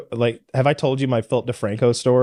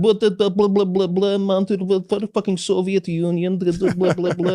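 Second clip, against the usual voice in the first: higher mock Russian gibberish; mock Russian babble; 5 semitones higher than usual. Imitating the lady speaking Russian to him, mock babble. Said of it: "starts speaking Russian to me".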